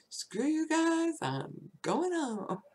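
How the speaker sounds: high, slow, bratty Cartman whine; sulky cartoon kid storming off; Cartman impression. Quoting a cartoon boy storming off, high and bratty. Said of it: "you know, Cartman".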